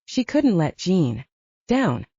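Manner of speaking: ordinary delivery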